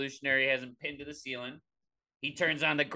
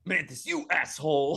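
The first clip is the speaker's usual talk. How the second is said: mock angry; 2 semitones higher, 4 dB louder than usual. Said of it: "he's dancing".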